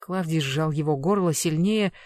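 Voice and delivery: plain reading voice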